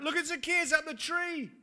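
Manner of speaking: high, loud jeering call from the crowd; shouted from the crowd. Retold or imitated. A bystander jeering at Zacchaeus up the tree, loud and high. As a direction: call out to mock the man up the tree. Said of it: "heard it shouted out".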